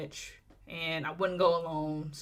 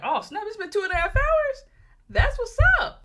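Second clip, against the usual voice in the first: high, loud surprised squeal; mock surprise; 7 dB louder than usual. Vlogger recalls the runtime, high surprised squeal. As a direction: gasp it like time flew by. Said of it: "and I'm like".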